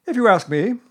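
high, loud, self-important bird; Owl. A narrator's reading. Owl offering Eeyore an opinion, high character voice.

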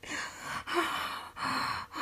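soft, voiceless panting exhale; out-of-breath sighs. Acted. Catching breath after being tickled, winded sighing. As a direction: let the breath out slowly, recovering. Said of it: "It hurts to breathe".

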